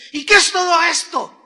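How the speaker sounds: pregunta acusadora, más alta y fuerte; encáralo con las pruebas; reclamo acusador. Acted. Confronta con las pruebas en mano. (esposa encarando al marido, reclamo fuerte y acusador)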